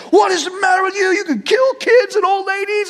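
high, loud, angry cop tirade; screaming scolding. Retold or imitated. Retold cop scolding a speeding teenager, screaming. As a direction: yell a furious telling-off. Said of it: "He's screaming".

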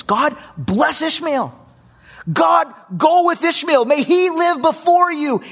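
high, loud, slow plea; earnest plea. Acted. Abraham begging God to bless Ishmael, high and loud. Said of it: "Instead of what Abraham does".

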